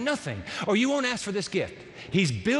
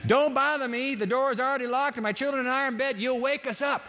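grumpy neighbour; 6 semitones higher than usual. Acted. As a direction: snap back from bed.

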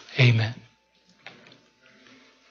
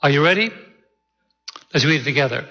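normal talking voice

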